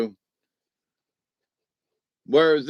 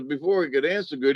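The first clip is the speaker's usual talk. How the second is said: usual speaking voice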